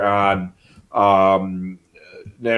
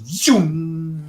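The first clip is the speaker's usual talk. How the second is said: drawn-out zoom of a passing plane; airplane whoosh; 5 semitones higher, 4 dB quieter than usual. Retold or imitated. Describing a plane passing the window, imitated drawn-out zoom. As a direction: stretch zoom out like a jet flying by. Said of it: "there's an airplane that goes".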